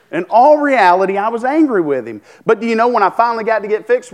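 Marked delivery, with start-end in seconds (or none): none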